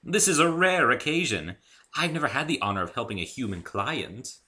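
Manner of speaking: high, loud and flustered; Rarity hastily reassuring her guest; hurried. Acted. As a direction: rush to stop him leaving.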